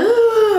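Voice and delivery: high-pitched "ooh" wail; imitated crying wail. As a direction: wail it like retold sobbing. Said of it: "I was crying".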